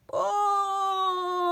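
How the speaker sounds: long, high, loud bellow; teacher charging round bellowing. A narrator's reading. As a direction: roar the word across the playground.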